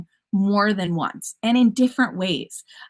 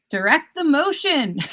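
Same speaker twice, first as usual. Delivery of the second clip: slow and clearly higher, "motion" drawn out for the pun; punny title read; 8 semitones higher than usual. Shown as a demonstration. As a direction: present the pun "motion" with a playful lift. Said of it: "I got a little punny here".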